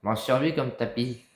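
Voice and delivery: shouting to someone in another room; calling out. Real, not acted. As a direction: call it out loud across the house.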